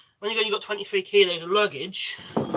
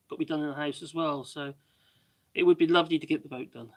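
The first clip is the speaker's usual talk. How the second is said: ordinary talk, nothing marked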